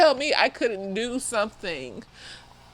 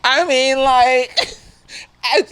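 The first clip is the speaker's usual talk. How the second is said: usual speaking voice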